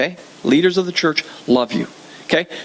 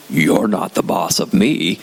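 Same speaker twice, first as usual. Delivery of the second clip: sulky rebel; 11 semitones lower, slower than usual. Retold or imitated. Rebellious response voiced.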